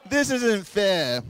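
slow, high, hammed-up sulky complaint; husband sulking at his wife, hammed-up; mock whine. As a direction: drag it out like a sulking kid.

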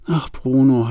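ordinary delivery, nothing marked

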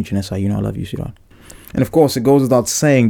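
normal talking voice

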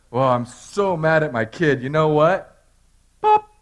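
slow, hammed-up spiteful God popping a tire; mock petty God. Pastor mocking a petty God popping a tire, hammed-up. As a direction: play God as a petty parent. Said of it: "his best move".

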